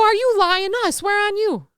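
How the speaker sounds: high-pitched retold squabble; mock-offended back-and-forth. Retold quarrel with Steve.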